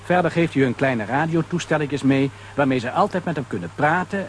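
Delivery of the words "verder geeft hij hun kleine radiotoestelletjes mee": usual speaking voice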